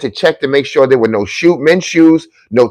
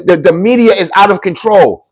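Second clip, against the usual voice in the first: ordinary delivery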